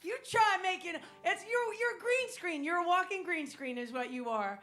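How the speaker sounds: loud, laughing tease; ribbing the green outfit loudly; excited teasing. Real, not acted. Teasing outburst about green outfit.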